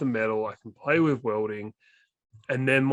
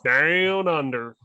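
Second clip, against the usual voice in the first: Down Under drawn out slowly and louder in an Australian accent; slow, broad Australian Down Under; 2 semitones higher, 5 dB louder, much slower than usual. Shown as a demonstration. Retrying the rhyme to a cohost in slow Australian accent. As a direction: lean into the Australian vowels of Down Under. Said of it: "it only rhymes with your accent".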